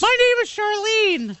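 high, slow put-on girly voice; woman's voice. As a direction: play a man faking a lady. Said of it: "Speaking to himself in a woman's voice".

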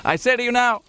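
hammed-up Obama rally to an empty room; mock Obama in an empty hall. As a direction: play Obama addressing a hall nobody came to. Mocking Obama's high voice.

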